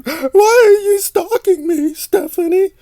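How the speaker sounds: very high, slow, whiny sobbing voice; hammed-up crybaby wail; mock crying whine. Vlogger mocks Aaron crying at Stephanie, in a high fake-sob whine. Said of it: "He's crying on the internet saying".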